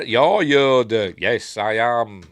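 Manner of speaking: loud, very slow declaration; hammed-up, stretched-out announcement; mock reveal. Luke realising it is Yoda, slow loud mock drama.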